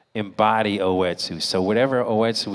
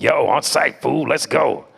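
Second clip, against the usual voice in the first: louder, higher street hype; amped-up, punchy challenge; hyped rapper character; 6 semitones higher, 7 dB louder than usual. Retold or imitated. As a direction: call it out like a battle rapper. Acting out freestyle rapper character.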